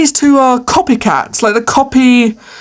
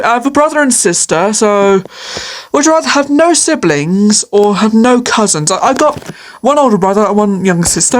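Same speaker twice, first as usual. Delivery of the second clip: usual speaking voice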